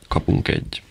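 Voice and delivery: usual speaking voice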